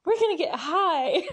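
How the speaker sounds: high, loud, giggly character voice; excited imitation breaking into laughter; Mallory. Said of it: "I can't do the Mallory voice".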